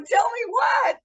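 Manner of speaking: high, loud, stunned question; shocked demand. Retold or imitated. Daughter stunned by her dad's confession, loud and disbelieving. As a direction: blurt it out in disbelief. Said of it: "And I'm like".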